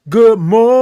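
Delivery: loud, high sung-out greeting; drawn-out morning greeting. Real, not acted. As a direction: hold the O of morning very long. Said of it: "the way that I say it".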